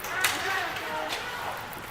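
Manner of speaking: short high burst of laughter; a quick amused laugh at the goalie. Real, not acted. Parent laughing at the goalie who never moved.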